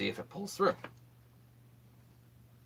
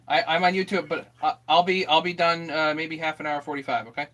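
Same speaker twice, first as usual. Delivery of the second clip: raised voice, higher and louder; calling out loudly; 5 semitones higher, 10 dB louder than usual. Real, not acted. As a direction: call it out across the house. Calling back to neighbor at door.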